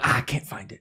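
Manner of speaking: hushed imitation of a lost presenter; soft, half-whispered mock fluster. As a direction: play the fumbling host under your breath.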